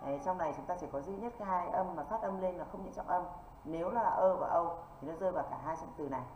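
ordinary talk

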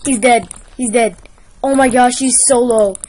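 normal talking voice

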